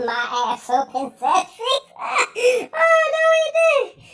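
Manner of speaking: real laughter, climbing very high and loud; cracking up. Real, not acted. Reading a crude joke about opening hours, cracking up. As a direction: lose it laughing, wailing the words.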